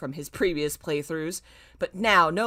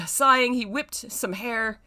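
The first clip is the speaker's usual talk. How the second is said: ordinary talk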